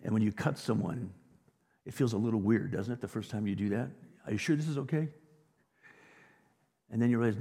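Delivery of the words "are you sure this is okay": nervous surgeon. Retold or imitated. Nervous first-time surgeon.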